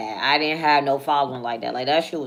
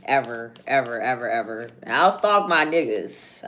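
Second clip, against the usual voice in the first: ordinary talk, nothing marked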